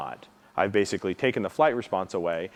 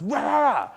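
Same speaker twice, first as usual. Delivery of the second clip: sudden, much higher barking rah; imitated dog lunge. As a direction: bark it out like a dog lunging at you. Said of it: "and it goes".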